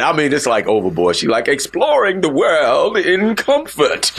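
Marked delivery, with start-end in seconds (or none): 1.5-4.1 put-on slow, overdone upscale ad accent; lightly mocking the cruise ad lady's posh voice. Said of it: "with this accent. overboard".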